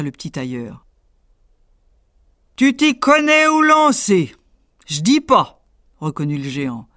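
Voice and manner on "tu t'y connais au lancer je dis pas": much higher, slow booming giant; grudging giant. Acted. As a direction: admit it grudgingly, slowly. Giant concedes.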